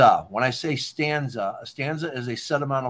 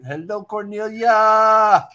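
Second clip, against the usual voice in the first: sing-song greeting; 8 semitones higher, 8 dB louder, slower than usual. Pretend. Loud playful greeting to Cornelia.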